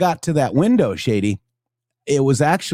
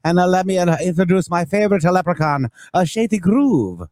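lightly put-on Irish accent, pitched higher; a playful, half-hearted Irish lilt for Saint Patty's; mock Irish accent; 5 semitones higher than usual. Host greeting viewers for Saint Patty's Day, hammed-up mock Irish accent. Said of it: "shocking Irish accent".

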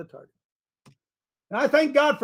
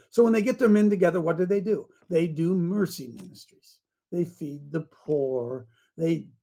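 normal talking voice

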